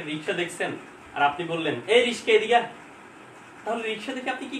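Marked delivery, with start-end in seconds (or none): 1.9-2.7 calling out. Pretend. Hailing a rickshaw on street.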